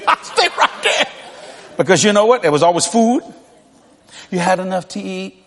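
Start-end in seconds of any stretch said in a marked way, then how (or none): 0.1-1.1 breathy laugh through the words, slow. Real, not acted. Preacher laughing about staying home, words breathy with laughter. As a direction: giggle it out, can barely speak.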